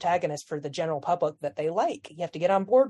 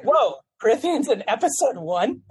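loud, higher, amazed; surprised; 5 semitones higher, 6 dB louder than usual. Retold or imitated. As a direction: blurt it out in disbelief. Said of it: "So I was like".

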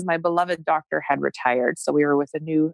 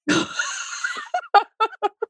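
real laugh. Real, not acted. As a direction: burst of high laughter. Relieved laugh after positive test.

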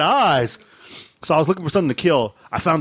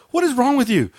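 mock wife voice; 8 semitones higher than usual. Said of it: "she's like".

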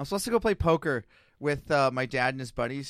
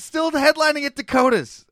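high, loud, defeated announcement; high loud self-mocking reply to the poker guys; loud mock answer; 11 semitones higher, 10 dB louder than usual.

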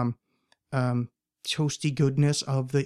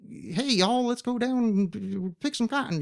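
put-on old farmer; 8 semitones higher, slower than usual. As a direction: drawl it like a Southern farmer. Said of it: "our farmer is more like a".

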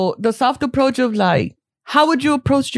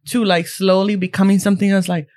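ordinary talk, nothing marked

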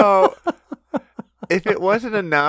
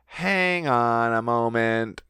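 slow, low dawning dread; slow worried realization; 3 semitones lower, slower than usual. Retold or imitated. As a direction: draw it out in sudden worry. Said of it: "people are like".

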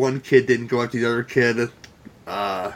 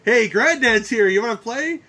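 playful granddad; 8 semitones higher than usual. Retold or imitated. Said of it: "he's really like".

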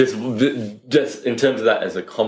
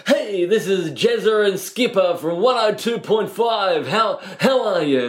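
much higher, slow, wound-up breakfast radio hosts; 9 semitones higher, slower than usual. Retold or imitated. Radio hosts greeting a guest in cheesy DJ voice. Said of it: "you're normal".

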